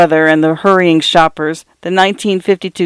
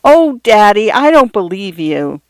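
lightly voiced girl, higher and delighted; happy little girls; 4 semitones higher than usual. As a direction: tease him like a happy daughter. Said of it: "they said like happy little girls".

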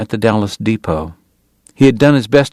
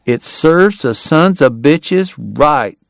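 higher, slow drawled grumble; aggrieved local, drawn out and pitched up; Southern bystander; 6 semitones higher, much slower than usual. A narrator's reading. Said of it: "someone remarked".

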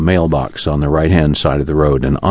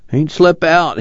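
put-on higher, tired groaning old man's complaint; moaning; 11 semitones higher, slower than usual. A narrator's reading. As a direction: moan it out, half asleep and put upon. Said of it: "he moaned".

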